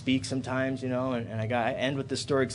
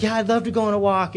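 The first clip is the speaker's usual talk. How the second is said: put-on high, eager little boy; a four-year-old who can't wait to go; super excited child; 7 semitones higher, 4 dB louder than usual. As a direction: son thrilled to go on a walk with his dad. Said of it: "super excited".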